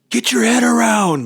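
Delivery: high, loud barked order; mock instructor yell. Instructor yelling at the rider to turn the head, loud. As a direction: yell it like a riding coach. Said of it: "them yelling at me about my head".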